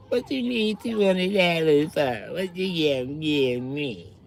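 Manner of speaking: hint of a sassy woman; Felicia's voice. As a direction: tease him for a price. Felicia answering Craig.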